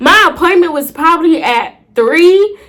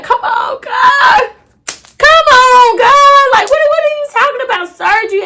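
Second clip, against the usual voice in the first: half-laughing mock sob; crying out to God on the table, high and desperate; retold crying plea; 10 semitones higher than usual. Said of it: "I'm on that table crying".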